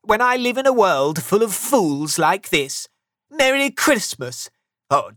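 high, loud miser's tirade; angry rant. A narrator's reading. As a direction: rail at Christmas in exasperation. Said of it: "Scrooge said angrily".

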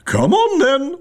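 very high roaring queen command; high-pitched royal bellow; roared. A narrator's reading. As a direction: holler it shrilly as a queen. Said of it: "roared the Queen".

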